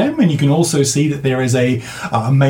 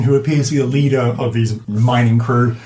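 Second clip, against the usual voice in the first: usual speaking voice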